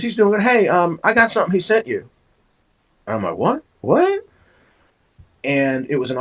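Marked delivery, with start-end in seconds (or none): 0.0-2.1 lightly voiced older lady, higher; a hint of a chatty older lady, pitched up; a bit higher, quicker, passing on a gift; lady's voice. Ms. McComb voiced in story. 3.4-4.2 high, rising "what? what?"; disbelieving double take, pitched way up; astonished. Retold or imitated. Boy's astonished reply.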